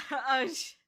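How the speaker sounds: ordinary talk, nothing marked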